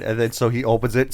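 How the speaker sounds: ordinary talk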